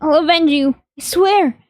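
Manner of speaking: high, pleading voice close to tears; desperate pleading. Acted. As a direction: beg Kazuki not to hate you. Begging Kazuki's ghost.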